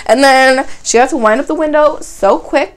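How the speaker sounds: usual speaking voice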